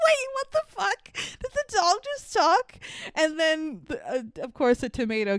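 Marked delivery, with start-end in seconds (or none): 0.1-2.8 much higher, incredulous squeaky retelling; mock disbelief. Watching the dog talk in the movie, high incredulous disbelief. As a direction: act baffled at the talking dog. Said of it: "I'm like".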